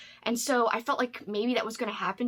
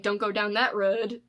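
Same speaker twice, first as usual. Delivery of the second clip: slow Maine drawl with "road" nearing "rud"; Judd's Maine accent. Retold or imitated. Reader imitating the audiobook's Judd, Judd's Maine accent. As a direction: imitate an old Maine neighbor's accent, slowly. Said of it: "So he says it like".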